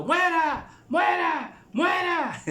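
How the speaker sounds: grito agudo y muy fuerte; gritando. A narrator's reading. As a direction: clamar como turba enfurecida. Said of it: "venía detrás gritando". (gritos de turba enardecida)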